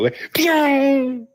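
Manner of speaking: high zappy pew; pew sound effect. Retold or imitated. Telling how everyone vanished, a high pew. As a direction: mimic a vanishing blip.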